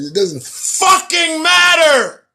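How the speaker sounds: high, loud outburst; yelled at full exasperation; exasperated shout. Real, not acted. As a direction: shout it as the rant boils over. Rant about coworkers boils over.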